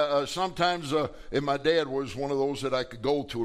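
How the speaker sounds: ordinary talk, nothing marked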